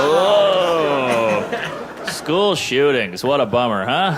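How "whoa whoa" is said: mock alarm. Real, not acted. Reacting to crowd.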